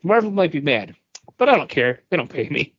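ordinary talk, nothing marked

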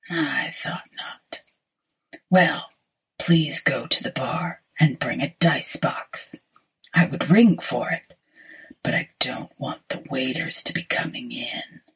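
whispered, low sinister stranger; low looming threat. Acted. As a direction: breathe it like a pale ghost. Pale stranger whispers his lines.

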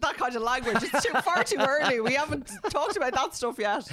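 high, loud mock outrage; mock scandalised. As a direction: pretend to be shocked.

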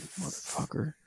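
acted-out hushed curse; retold muttered curse. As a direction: whisper the curse under your breath. Retold stunned villain's curse.

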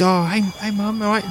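put-on high-pitched young girl greeting her mum; young girl's voice. Thirteen-year-old greets her mum, young girl voice. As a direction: play a girl pitched well above your voice. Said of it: "thirteen-year-old girl comes running over".

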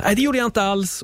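hammed-up flustered denial. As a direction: squeak it out as if caught denying. Imagined self-denial of a feeling.

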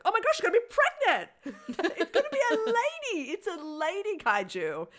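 высокий визг восторга с смехом; excited squeal. Retold or imitated. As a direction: squeal it out, laughing with delight. Excited recap of film moment.

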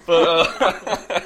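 high, laughing through the words; giggling at the accent joke. Real, not acted. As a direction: break up laughing mid-sentence. Cracking up after accent joke.

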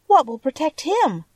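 put-on anxious girl's high-pitched question. A narrator's reading. Girl fears for her dog's safety, anxious. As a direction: worry aloud, pitched well up. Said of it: "said the girl anxiously".

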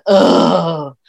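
loud, drawn-out mock groan; exaggerated "ugh" mimicking a friend; imitated swooning groan. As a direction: groan as a smitten fan would, hammed up. Imitates friend's reaction to guest.

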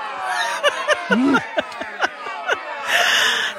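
a burst of breathy high laughter; laughing hard at the plot twist; big high laugh. Real, not acted. Podcaster cracks up at the book, laughing.

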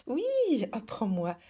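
high squealing laugh; being tickled. Acted. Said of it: "arrête de me chatouiller".

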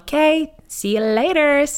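bright, high and loud see-you-later; chirpy farewell, high and loud, hiding the tears; breezy tough goodbye. Retold or imitated. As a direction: brush it off like it's nothing. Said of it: "så er jeg tøffere enn hun".

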